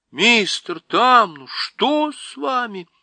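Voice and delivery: high, slow, alarmed cry of concern; girl startled by the Faun's tears; alarmed exclamation. Acted. Lucy crying out in alarm as the Faun weeps. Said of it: "она воскликнула".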